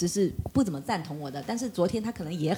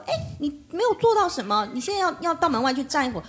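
raised-pitch mock telling-off of a child; acted-out scolding, pitched up; stern scolding; 7 semitones higher than usual. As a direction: re-enact a mother's strict order to go stand outside. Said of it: "首先我就很凶他".

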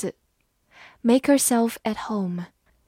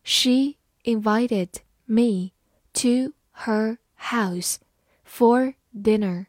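slow and spaced, phrase by phrase; slow, careful demo read; much slower than usual. Shown as a demonstration. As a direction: read it slowly for the class to follow. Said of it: "好，慢慢来读。".

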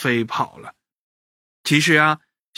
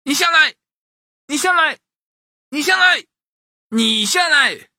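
shouting match; 11 semitones higher, 4 dB louder than usual. A narrator's reading. Boy and monkey shout back and forth, insistent. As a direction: trade high shouts, stretching the final one. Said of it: "喊了半天".

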